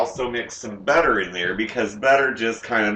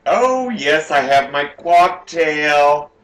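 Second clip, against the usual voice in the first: tipsy excitement; 4 semitones higher, 6 dB louder, slower than usual. Real, not acted. Excited about cocktail.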